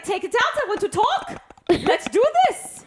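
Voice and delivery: high, insistent mock Scottish; mock Scottish accent. Mom demands dad talk on drive, high mock Scottish. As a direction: demand attention in a high put-on Scottish lilt. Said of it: "these two talking to each other".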